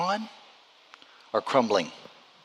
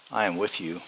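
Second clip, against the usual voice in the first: soft, low "I am with you"; soft promise; 6 semitones lower, 8 dB quieter than usual. Shown as a demonstration. Pastor gently assures the congregation, softly. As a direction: say it gently, almost to yourself. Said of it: "repeated promise, softened".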